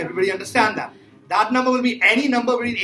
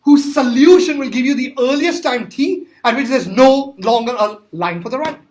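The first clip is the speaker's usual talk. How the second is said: reading the question aloud; 2 semitones higher, 5 dB louder than usual. Shown as a demonstration. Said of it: "It says".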